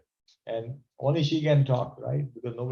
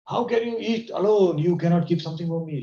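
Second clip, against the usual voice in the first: angry Parvati; 7 semitones higher, 3 dB louder than usual. Retold or imitated. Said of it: "She was very angry".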